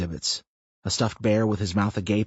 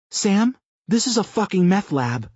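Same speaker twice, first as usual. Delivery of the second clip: shocked realization; 11 semitones higher, 4 dB louder than usual. A narrator's reading. Tina realizes what the lab is.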